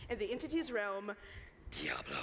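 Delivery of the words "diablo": deep growling demon voice. Acted. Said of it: "I got to do my demon voice".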